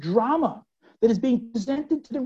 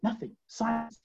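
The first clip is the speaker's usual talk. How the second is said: usual speaking voice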